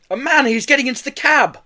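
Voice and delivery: high, loud warning; urgent alarm. A narrator's reading. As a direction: call out the intruder urgently. Raising the alarm after yelling thief.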